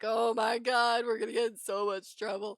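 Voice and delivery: high, loud imitation of a freaked-out friend; hammed-up panicked wail; mock panic. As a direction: play the lab partner losing it. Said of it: "lab partner's just losing".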